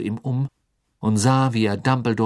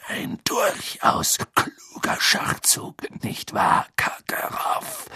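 raues Flüstern, langsam; spöttisch raunen; gemurmelt; 12 semitones higher, slower than usual. Acted. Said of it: "murmelte Moody". (Moody raunt Karkaroff spöttisch zu)

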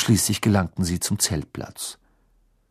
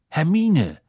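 a firmer, pressing call of her name, raised high; calling Hermine with urgent insistence; more urgently; 7 semitones higher, 4 dB louder than usual. A narrator's reading. Father figure urging the girl away, urgent. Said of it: "etwas eindringlicher".

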